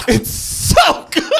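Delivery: high, slow, swooning praise; gushing excited. Real, not acted. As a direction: swoon over it. Gushing about the show.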